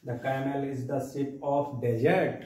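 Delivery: "The camel is the ship of desert" read very slowly, falling on desert; slow repeat. Shown as a demonstration. Teacher repeating the homework sentence slowly for students. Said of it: "हमने आपको एक sentence दिया है".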